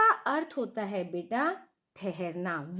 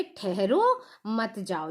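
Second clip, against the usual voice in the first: slowly, with ठहरो cut off from मत जाओ; pause after ठहरो; 2 semitones lower, slower than usual. Shown as a demonstration. Teacher telling students to stop here, pause after ठहरो. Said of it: "हमें रुकने के लिए कह रहे हैं".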